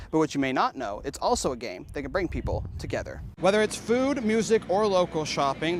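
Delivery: steady anchor-style report; news read. Acted. Reporter reads news packages.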